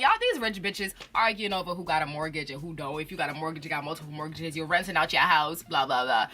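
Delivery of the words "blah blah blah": ordinary delivery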